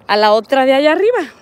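ordinary talk, nothing marked

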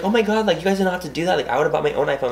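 high excited retelling of her shock; retold surprise. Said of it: "I was, like, so shocked".

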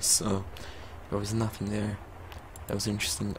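ordinary talk

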